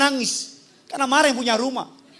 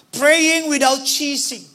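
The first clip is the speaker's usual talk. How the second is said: slow emphatic slogan; 3 semitones higher, much slower than usual. Acted. English slogan drawn out slowly.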